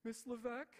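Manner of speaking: high, loud, mock-tearful child voice; imitating near-tears daughter. Daughter on phone to teacher, mock tearful high voice. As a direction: squeak it out as if holding back crying. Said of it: "she's trying not to cry".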